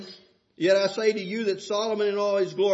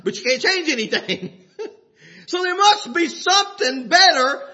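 loud, higher preaching broken with laughter; preaching at full force; 8 semitones higher, 7 dB louder than usual. Acted. Preacher laughing at useless worry, shouting to the congregation.

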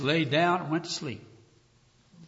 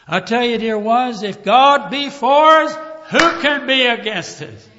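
raised, loud preacher's exhortation; preaching; 10 semitones higher, 9 dB louder than usual. Acted. Preaching at full force.

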